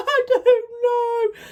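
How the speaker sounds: very high mock wail; hammed-up helpless bawling; imitated crying. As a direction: squeal it tearfully. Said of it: "Just sat there crying".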